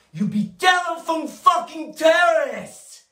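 high, climactic war cry; rallying shout. Acted. Boss sends brokers off to the phones, rallying shout. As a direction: send the troops off at a high yell.

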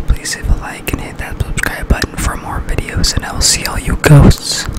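mock-ASMR whispered sign-off; playful whisper. Vlogger signing off to the ghosts after an ASMR challenge, in a whisper.

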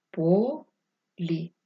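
по... лі, drawn out in two parts; syllable-by-syllable dictation. Shown as a demonstration. Teacher dictating the last word, syllable by syllable.

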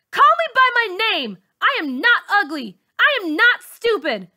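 very high, loud defiant yell. Acted. Girl finally yelling back at her bully, high and loud. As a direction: stand up and scream your pride. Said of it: "I yell".

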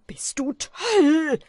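hoch, laut, langsam gekreischt; wie ein Wahnsinniger. A narrator's reading. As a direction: schrei ihn irre an. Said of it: "schrie Wim wie ein Wahnsinniger". (Wim schreit Kaspar wahnsinnig an)

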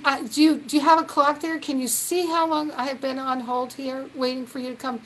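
fast, loud, exasperated rant at the bank clerk; indignant caller fed up with waiting; impatient old self. Retold or imitated. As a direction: rant like an impatient customer kept on hold. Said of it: "my old way would have been".

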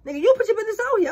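high, loud and fast comeback; loud fast mock clapback; mock retort. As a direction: snap back at the friend, hammed up. Acting friend's retort.

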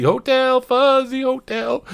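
mocking. Mocking reddit username.

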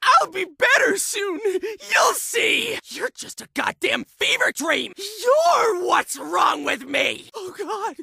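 agitated patient lashing out at voices; frantic, erratic outburst; tormented, deranged shouting. Acted. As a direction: shout as if cornered and losing your mind.

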